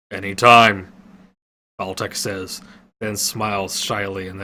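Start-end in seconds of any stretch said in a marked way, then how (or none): 0.1-0.9 gruff fighter. A narrator's reading. Fighter answers shyly.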